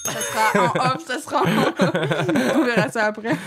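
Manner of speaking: giggling through the words; laughing. Real, not acted. Giggling while teasing about footage.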